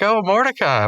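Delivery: high, eager greeting; lightly voiced Pops. Pops greeting Mordecai as he walks up, high voice. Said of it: "he's like".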